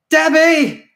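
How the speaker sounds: frantic shout; desperate shout. A narrator's reading. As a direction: scream her name in despair. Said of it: "I shouted desperately".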